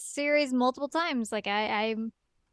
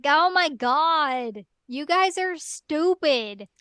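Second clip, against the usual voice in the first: slow, drawn-out mock exasperation; 4 semitones higher, much slower than usual. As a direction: sigh it out as fed up. Voicing annoyed Kagome.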